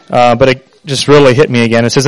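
usual speaking voice

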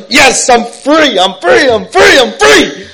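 high, loud and slow, stretching every word; slow joyful shout. Retold or imitated. Driving down the road shouting his freedom, slow and loud. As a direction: cry it out to the sky.

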